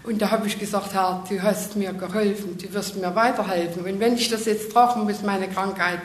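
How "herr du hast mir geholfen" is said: normal talking voice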